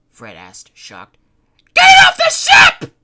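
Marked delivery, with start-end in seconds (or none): 1.7-3.0 much higher, much louder repeated shriek; panicked scream. Acted. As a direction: scream the order again. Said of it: "she repeated".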